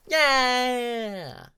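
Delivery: very high, loud joyful squeal; excited dragonette. Acted. Bobcat the baby dragon cheering on his father's back. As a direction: squeal with pure delight like a little child. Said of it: "the excited voice of a dragonette".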